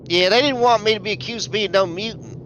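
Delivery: normal talking voice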